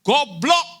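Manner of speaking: high, loud shout; child's imitated yell. Son yelling at careless students from the car, mimicked shout. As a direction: yell it like a kid copying Dad. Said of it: "anaknya teriak".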